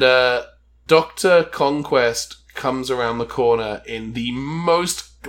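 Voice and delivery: usual speaking voice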